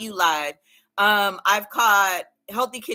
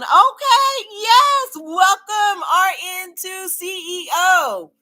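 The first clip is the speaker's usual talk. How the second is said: excited greeting; 8 semitones higher, 6 dB louder, slower than usual. Real, not acted. Excited greeting of new viewer.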